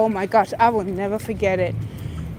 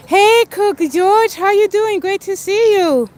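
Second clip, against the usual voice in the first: bright greeting; 8 semitones higher, 4 dB louder than usual. Real, not acted. Greeting Cook George in the chat, a high cheery welcome.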